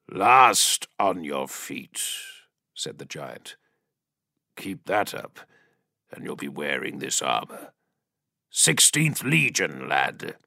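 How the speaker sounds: vox-filtered giant. A narrator's reading. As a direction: speak like a giant behind a helmet grille. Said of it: "vox-filtered voice of the giant".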